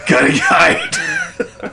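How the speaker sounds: high, slow squeaky laughing voice; laughing panic. Retold or imitated. Son hiding from his mom, giggling. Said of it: "I'm like".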